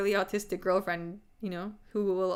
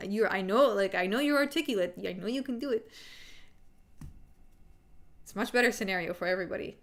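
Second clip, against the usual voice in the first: normal talking voice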